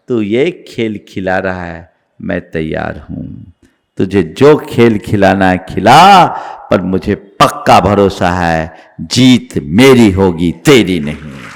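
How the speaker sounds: very low, steely challenge. Acted. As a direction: stand your ground with hushed resolve. Acting Anjana's calm challenge to karma.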